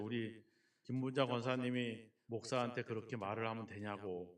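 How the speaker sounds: low, soft, mildly wheedling reproach; sly teasing. Retold or imitated. Pastor son teasing his mother about her nagging, slyly. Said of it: "능글맞게 얘기를 하죠".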